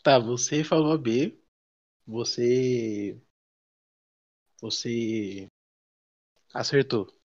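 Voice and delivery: slow, low, dragged-out reveal; suspense reveal. Pretend. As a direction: build suspense before the result. Suspenseful quiz result reveal.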